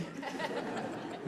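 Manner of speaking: soft laugh. Real, not acted. Laughs at dad's remark.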